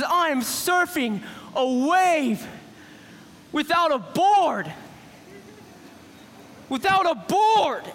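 slow, drawn-out marvel; acted wide-eyed astonishment; amazed realization. Peter realizes he is surfing.

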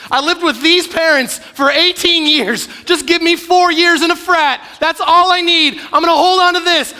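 high, loud, slowing bratty bargaining; a kid begging for four wild years, high and loud; mock teen pleading. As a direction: plead like a teen clinging to freedom. Teen bargaining to keep his life.

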